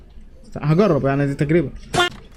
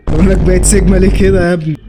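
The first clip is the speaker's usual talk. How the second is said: loud teasing call; 7 dB louder than usual. Real, not acted. Reacting to game character.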